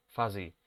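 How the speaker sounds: "Fuzzy" low, FA-zi; pronouncing fuzzy. Shown as a demonstration. Teacher repeating the adjective for students. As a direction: fall on "Fuzzy".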